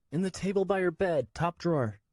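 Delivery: put-on high young boy's voice, pleading; a small boy reminding the man; child voice. Nico to Swen, a high boy's voice.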